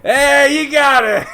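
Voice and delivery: high, loud cheer of praise. Real, not acted. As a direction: cheer them on like a game show host. Host cheering a correct answer.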